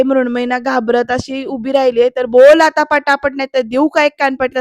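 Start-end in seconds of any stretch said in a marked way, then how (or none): 2.3-4.6 loud, slowed mock threat; loud attack on the opening words, menacing; threatening. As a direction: imitate an angry father demanding an answer. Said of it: "असं म्हणून अंगावर जातो".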